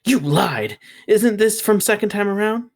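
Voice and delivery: high squawking outrage; squawky. A narrator's reading. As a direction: squawk it like an offended bird. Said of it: "then squawkily".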